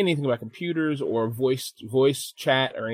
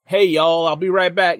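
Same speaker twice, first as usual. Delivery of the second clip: louder, higher mock country call; 4 semitones higher, 7 dB louder than usual. Imitating his friend excusing himself from Discord, calling out. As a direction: imitate him hollering to the group. Imitated gamer calls to group.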